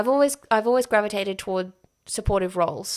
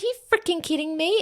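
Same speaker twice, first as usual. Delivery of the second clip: high, fast, incredulous squeal; retelling her shock at the fan, a high fast disbelieving squeal; disbelieving outburst; 10 semitones higher, faster than usual. Retold or imitated. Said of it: "And I was like".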